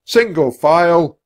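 loud, slow, drawn-out command; centurion's bellow. A narrator's reading. Centurion ordering troops out, bellowing. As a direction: bellow a drill order, stretching it out. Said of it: "the centurion bellowed".

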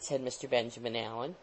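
ordinary delivery, nothing marked

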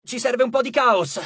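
high-pitched rallying call; shouted order. A narrator's reading. Frank to Piper amid battle, shouted call. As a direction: shout for some mayhem. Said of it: "gridò Frank".

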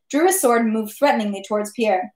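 usual speaking voice

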